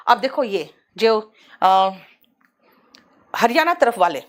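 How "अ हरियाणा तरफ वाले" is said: usual speaking voice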